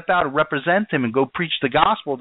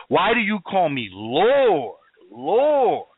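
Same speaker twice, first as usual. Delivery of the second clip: "Lord, Lord" stretched, each rising then falling; slow, weighty stress on both "Lord"s; double emphasis on LORD; slower than usual. Acted. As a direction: give each "Lord" its own long swell. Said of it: "double emphasis".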